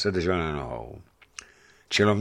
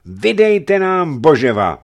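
high, loud, slow demand; angry shout; 9 semitones higher, 10 dB louder than usual. A narrator's reading. The crowd demands the boyar again, angry shout. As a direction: shout it over the mob.

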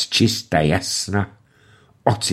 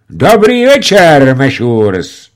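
loud, high, slow greeting; taken aback; 7 semitones higher, 10 dB louder, slower than usual. A narrator's reading. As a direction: caught off guard. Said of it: "poněkud zaražen". (zaraženě pozdravit)

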